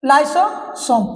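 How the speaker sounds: Lysosome drawn out, split into lyso and सोम; slow, careful pronunciation. Shown as a demonstration. Teacher drilling students on the new term, slow and careful. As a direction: stretch lysosome slowly, pausing before सोम. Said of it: "इसको ध्यान से पूर्वक से".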